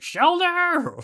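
very high, loud Shelder squeal; high-pitched creature cry. Acted. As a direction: scream the name like a tortured clam. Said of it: "it's, like, really high-pitched".

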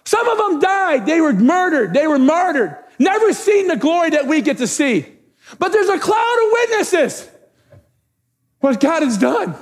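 high, ringing preacher's cry; rally the congregation to declare it; full-force preaching. Real, not acted. Pastor rallying church with heroes of faith, full-force preaching.

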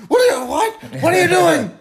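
high, loud startled shout; mock panicked yelling. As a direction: yelp it as if jolted awake. Acts out everyone waking in alarm.

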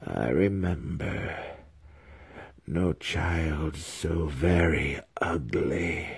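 soft, very slow spectral voice; ghostly spirit. A narrator's reading. Dead mother's spirit disowns her son, soft slow ghostly voice. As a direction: draw it out softly like an apparition.

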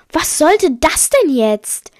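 loud, high, slow protest; indignant call. A narrator's reading. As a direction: call out again in drawn-out indignation. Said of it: "rief ich zum zweiten Mal".

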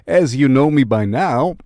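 normal talking voice

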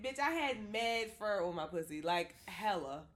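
ordinary talk, nothing marked

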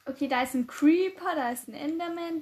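speaking up clearly. Real, not acted. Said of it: "Du musst lauter reden".

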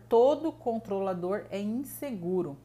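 ordinary talk

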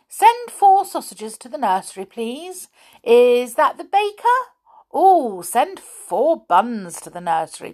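grand phone voice. Acted. Amelia plays at ordering by phone.

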